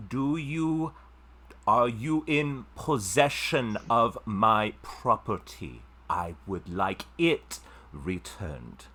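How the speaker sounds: slow, loud, over-clear words as to a foreigner; slow condescension. Acted. Noblewoman speaking slowly to a stranger who might not know common. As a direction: spell it out slowly to someone who can't follow. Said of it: "she goes".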